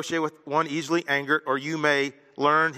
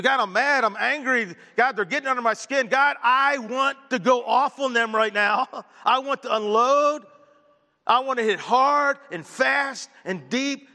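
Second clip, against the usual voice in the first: higher, slowed, heated venting to God; mock angry prayer; 8 semitones higher, 3 dB louder, slower than usual. Pastor acting out an angry prayer to God, slow and heated. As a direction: pour out mock fury in prayer. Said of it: "And admit it to God".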